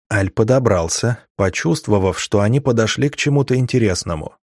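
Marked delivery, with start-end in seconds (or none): none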